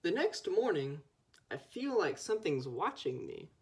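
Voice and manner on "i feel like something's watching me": usual speaking voice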